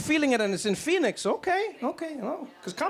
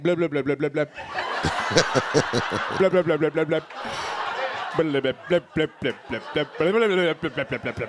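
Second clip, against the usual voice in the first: low blips; 5 semitones lower, 4 dB louder than usual. Retold or imitated. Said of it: "and then was like".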